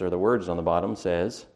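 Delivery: ordinary talk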